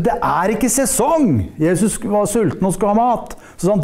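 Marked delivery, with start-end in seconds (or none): 0.0-1.3 mock cry in a higher voice; hammed-up protest without real volume; fig tree calling out. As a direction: call it out as a protesting tree, pitch raised. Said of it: "Når fikentreet ropte".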